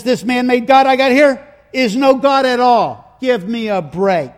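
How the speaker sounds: loud, high, scoffing protest; acted indignant shout; indignant outburst. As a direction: complain loudly as an outraged tradesman. Acting out the silversmith's angry complaint.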